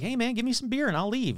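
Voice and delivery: mock mermaid. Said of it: "mermaids are like".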